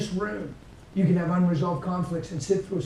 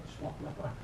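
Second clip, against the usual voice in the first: hushed whisper, barely voiced; whispered repeat; 2 semitones lower, 11 dB quieter than usual. Shown as a demonstration. Preacher repeats line for effect.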